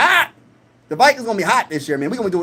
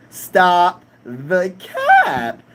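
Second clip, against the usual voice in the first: drawn-out catchphrase; 2 semitones lower, much slower than usual. Shown as a demonstration. Streamer to chat, dragging "Stop the cap" out slowly. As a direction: stretch every word out very slowly.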